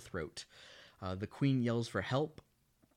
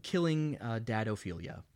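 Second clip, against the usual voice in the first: ordinary talk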